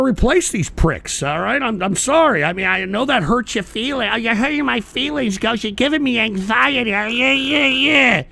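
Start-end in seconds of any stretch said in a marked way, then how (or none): 4.1-8.2 higher, whining parody of a hurt listener; mocking whiny voice. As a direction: fake wounded feelings to ridicule Ghost. Mocks Ghost's hurt feelings.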